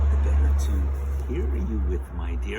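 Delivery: very loud, low wolf voice; wolf posing as Granny. Acted. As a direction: boom it out like the big bad wolf. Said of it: "the wolf says".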